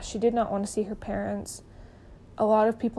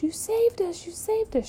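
much higher, tearful imitated cry; imitating bawling; 10 semitones higher than usual. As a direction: mimic a sobbing rescued girl. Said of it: "She's bawling".

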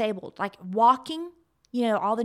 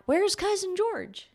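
imitated puzzled family; 8 semitones higher, slower than usual. Acts out family calling for cousin.

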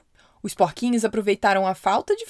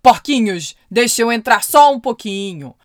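big bad wolf; 9 dB louder than usual. Wolf begs pigs to let him in.

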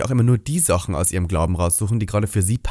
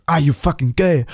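mock aggressive; 3 semitones higher, 3 dB louder than usual. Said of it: "wird er so aggressiv".

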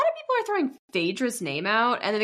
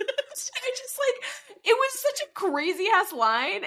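high, slower, amazed; excited; 12 semitones higher, slower than usual. Real, not acted. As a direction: marvel at how wild it was. Recalling a wild line.

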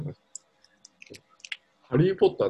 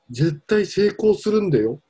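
higher, slow, insistent grandfather urging; imitating grandfather; 10 semitones higher, 4 dB louder, slower than usual. Quoting his grandfather on the phone, a slow insistent urging. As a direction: press the point like a pushy grandpa. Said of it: "みたいなこと言われた".

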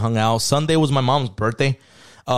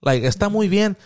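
approving mom imitation; 6 semitones higher than usual. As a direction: praise him like a mom pretending to approve. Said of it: "She's like".

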